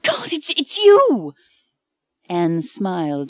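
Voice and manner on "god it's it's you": high stammering shriek of shock; scream. A narrator's reading. Mike screaming at Anne waking in his bed, high startled shriek. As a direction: scream it out in disbelief. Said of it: "Mike screamed".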